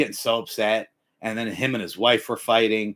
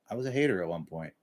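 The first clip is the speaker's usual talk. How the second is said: low, soft and quick; quiet admission; 7 semitones lower, 8 dB quieter, faster than usual. Real, not acted. Speaker confessing to viewers, quiet and low. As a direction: admit it under your breath.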